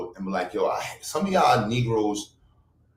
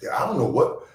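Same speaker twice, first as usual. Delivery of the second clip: ordinary talk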